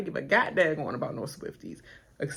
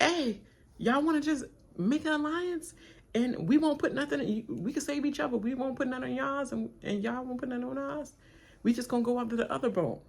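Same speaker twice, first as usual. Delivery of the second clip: higher, sing-song mock voice; mimicking Natalie's pitch; 7 semitones higher than usual. Natalie pitching a deal to the other team, in a high mock voice. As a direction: play the sly castmate pitching an alliance, pitched up. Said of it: "And she was like".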